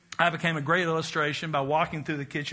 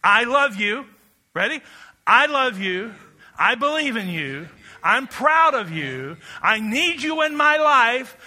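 louder, higher proclamation of each phrase; emphatic declaration; 5 semitones higher, 6 dB louder, slower than usual. Shown as a demonstration. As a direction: proclaim each one to the crowd. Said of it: "So here they are, the six sounds".

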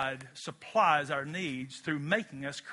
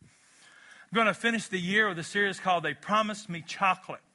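usual speaking voice

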